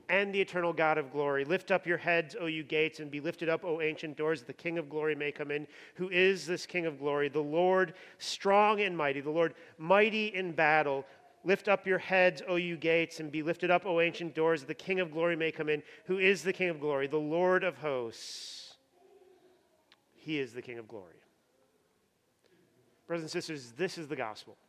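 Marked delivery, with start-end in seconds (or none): 1.4-21.2 solemn psalm reading. Acted. 23.1-24.6 hushed. Acted. Repeated declaration, dropped to hush.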